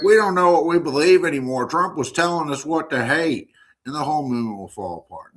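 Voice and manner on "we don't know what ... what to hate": higher, louder, lightly voiced lost followers; mock confused MAGA fans. Host mocking MAGA fans left without Trump, in a put-on bewildered voice. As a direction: mimic a follower who has lost the plot. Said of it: "they're gonna be like".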